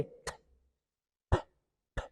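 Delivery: "t" as isolated tongue-tip bursts of air; plosive "t" demo. Shown as a demonstration. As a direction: release each "t" alone from behind the upper teeth. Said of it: "when it opens and the air flows".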